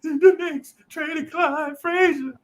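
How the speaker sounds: high, slow, crying wail; sobbing kid. Retold or imitated. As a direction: blubber it out like a heartbroken eight-year-old. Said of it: "and I'm crying".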